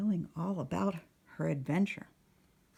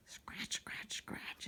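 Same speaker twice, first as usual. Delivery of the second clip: very soft whispered scratch; scratching sound; 13 dB quieter, much slower than usual. Acted. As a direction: whisper it like tiny teeth scratching. Mouse gnawing the ropes.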